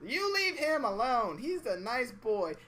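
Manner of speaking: put-on high, slow little old lady scolding; a granny telling him off, high and slow; old woman voice. Customer's granny telling the man, high old lady voice.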